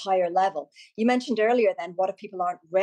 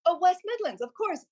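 higher, a real penny-drop surprise; delighted discovery, voice jumping up; sudden realisation; 10 semitones higher than usual. Real, not acted. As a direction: let the realisation hit you. Realises what W M means.

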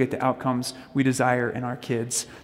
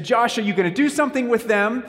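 higher-pitched exasperated wife; raised voice over noise; 8 semitones higher than usual. Retold or imitated. Wife getting above the kids' noise to reach her husband, raised voice. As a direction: imitate a wife calling over the kids' din. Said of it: "get above them to get to me".

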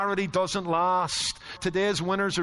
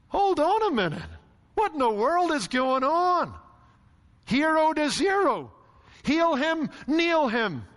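much higher, building rhetorical disbelief; incredulous outcry; 9 semitones higher than usual. Acted. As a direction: hold on, what is going on here, hurl it at the room. Preacher's mock disbelief at crowd.

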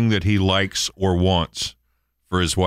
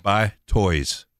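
talking calculator voice; 4 semitones lower than usual. Retold or imitated.

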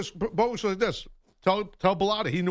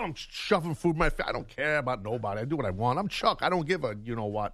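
normal talking voice

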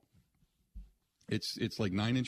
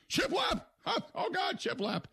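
very high-pitched imitation of a woman crying out; mock passionate outburst. Host imitating TV host in throes of passion, mock high-pitched cry. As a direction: squeal it in a hammed-up falsetto. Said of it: "she just shouts it out like".

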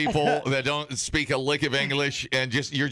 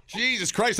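usual speaking voice

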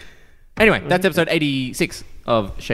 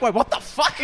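baffled outburst; 12 semitones higher, 3 dB quieter than usual. Retold or imitated. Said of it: "and I'm like".

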